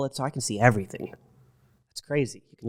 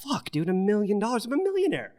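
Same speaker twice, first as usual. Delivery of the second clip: put-on much higher, like a kid who just struck it rich; giddy disbelief; 9 semitones higher than usual. Telling his podcast cohost about the windfall, giddy high-pitched disbelief. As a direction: blurt it out in giddy shock. Retelling sudden million-dollar windfall.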